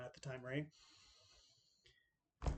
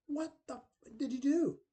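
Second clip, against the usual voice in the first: a much higher, loud outraged yell; angry yell; 10 semitones higher, 4 dB louder than usual. Retold or imitated. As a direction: confront her at full volume. Brother confronting sister over cheating.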